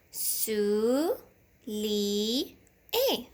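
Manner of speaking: "Sou, li, et" as three separate beats; teacher sounding out each syllable; syllables apart. Shown as a demonstration. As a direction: chop the word into pieces with pauses. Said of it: "Coupons les syllabes".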